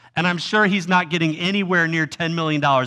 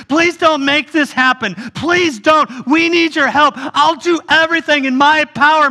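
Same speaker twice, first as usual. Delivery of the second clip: pleading higher, begging over and over; desperate pleading; 5 semitones higher than usual. Retold or imitated. Debtor begging his master for mercy, desperate pleading. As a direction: plead with the master as if your life depends on it. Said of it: "falling down and begging, pleading with the king".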